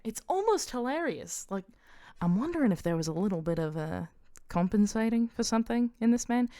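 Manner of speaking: gossipy Australian character voice; Australian accent. Acted. Mania gossiping about the Old King, Australian accent. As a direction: draw it out in Australian. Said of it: "that she turned Australian".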